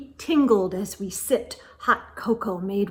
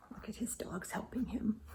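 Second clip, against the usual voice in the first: quick unvoiced whisper; 2 semitones lower, 19 dB quieter, faster than usual. Real, not acted. Parent showing the child the picture, whispering. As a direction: point it out in a hushed whisper.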